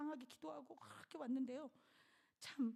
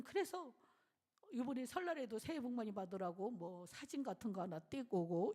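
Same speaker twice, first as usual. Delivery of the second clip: usual speaking voice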